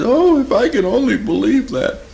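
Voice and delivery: high-pitched tearful wail, imitated; mock crying. A client longing to believe she is forgiven, mock crying. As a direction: imitate a client wailing through tears. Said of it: "she started to cry".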